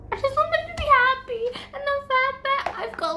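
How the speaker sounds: high, pleading whine. Real, not acted. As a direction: beg the book for a happy ending. Desperate about the book.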